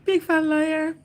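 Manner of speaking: high, slow teasing through laughter; mock Johnny while cracking up; laughing tease. Teasing a viewer as a liar while laughing.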